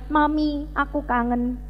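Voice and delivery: quietly voiced homesick plea. Student writing to mother from afar, softly homesick.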